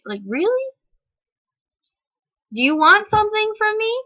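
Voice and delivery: can't believe she's being followed out; incredulous. Acted.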